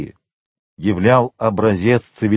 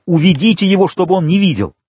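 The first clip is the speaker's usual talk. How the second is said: frantic order; 7 semitones higher, 4 dB louder, faster than usual. A narrator's reading. Frantic father orders servants.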